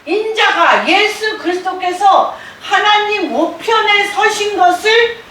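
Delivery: high-pitched, proclaiming shout; loud cry. Acted. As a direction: cry it out like Stephen proclaiming a vision. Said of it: "큰 소리를 지르며". (높은 음으로 크게 외치듯)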